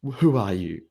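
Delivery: playfully incredulous wife. Acted. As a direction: act out baffled disbelief, punched up. Wife disbelieving attentive husband.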